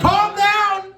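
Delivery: loud high shout at the crowd. Real, not acted. Comic calling out to the rowdy audience, loud shout. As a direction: yell it to settle the room.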